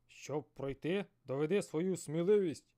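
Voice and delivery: loud booming sea serpent; thunderous serpent voice. Acted. Giant serpent challenging the fisherman, loud and booming. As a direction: boom it like thunder. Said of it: "голосом, що нагадував грім".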